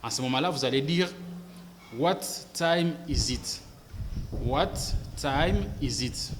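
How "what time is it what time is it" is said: slow, deliberate What time is it; slow model phrase. Shown as a demonstration. Teacher modelling the informal question, slow. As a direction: say it slowly for copying. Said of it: "si vous voulez sonner comme un anglophone".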